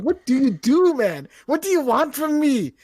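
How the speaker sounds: loud, high, pleading at the console; what do you want from me; exasperated rant. Retold or imitated. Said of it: "I'm like".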